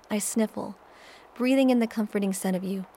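plain reading voice

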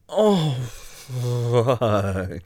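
loud, drawn-out moan of pleasure. Acted. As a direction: stretch the words into a groan. Overcome by pleasure.

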